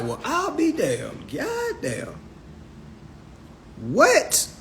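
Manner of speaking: slow, high, hushed disbelief; stunned amazement. Retold or imitated. Man learning of a native tongue hours away, stunned amazement. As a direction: let it out softly, astonished. Acted stunned reaction to the research discovery.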